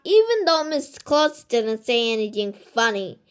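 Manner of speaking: ordinary delivery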